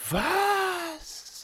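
high astonished squeak. A narrator's reading. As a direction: gasp it in disbelief. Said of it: "fielen fast die Augen aus dem Kopf".

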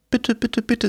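fast, high, begging crone voice; pleading old woman. Acted. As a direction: rattle off pleas like a panicked old lady.

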